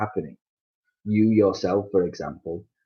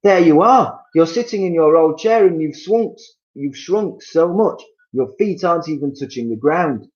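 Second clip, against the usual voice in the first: much higher, louder triumphant shout; husband shouting at his seated wife; 10 semitones higher, 8 dB louder than usual. Acted. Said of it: "pointed at her and shouted".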